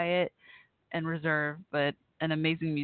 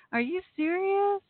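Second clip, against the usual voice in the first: much higher, slower, amazed and near tears; overwhelmed disbelief; 12 semitones higher, slower than usual. Real, not acted. Host touched by a friend remembering her, overwhelmed and near tears. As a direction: let the joy almost break through. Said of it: "You're gonna make me cry".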